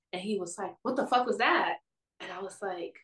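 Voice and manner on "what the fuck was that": retold jolt of alarm, higher pitched; startled outburst. Date waking her in the night, startled. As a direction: imitate someone jumping up startled. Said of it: "then he jumps up".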